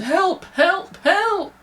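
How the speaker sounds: very high, rising terrified cries; panicked shrieks; yelled for help. Acted. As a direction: scream for help. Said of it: "A woman yelled".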